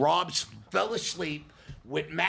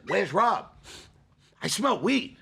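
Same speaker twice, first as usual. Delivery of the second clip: louder and higher, sniffing out trouble; alarmed dad, louder; raised alarmed voice; 6 semitones higher, 4 dB louder, slower than usual. Retold or imitated. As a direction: call it out, suspicious and loud. Dad confronting, smelling weed.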